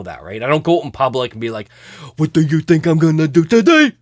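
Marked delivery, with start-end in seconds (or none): 2.2-3.9 loud, silly mock character; playful put-on voice, projected; goofy put-on voice. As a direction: ham it up in a goofy show voice. Demonstrates his silly show voice.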